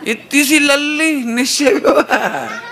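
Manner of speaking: high, laughing mock mother; amused mother. Mother laughing at her little daughter's big words. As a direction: chuckle at the tiny girl, high. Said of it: "तो mummy कहेगी".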